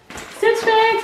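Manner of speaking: ordinary delivery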